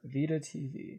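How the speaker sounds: muffled, spoken into a hand; hand over mouth. Real, not acted. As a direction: talk through your palm. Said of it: "I just talked into my hand".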